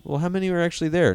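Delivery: high, fast curious question; eager questioner. Acted. Acted alien quizzing.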